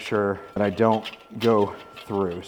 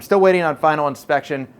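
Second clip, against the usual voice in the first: normal talking voice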